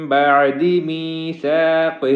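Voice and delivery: slow, nasal hiding on من بعد; میثاقہ with a light, thin ثا; slow, careful recitation of بعد میثاقہ; tajweed demo. Shown as a demonstration. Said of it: "نون ساکن کی وجہ سے اخفا ہوگا".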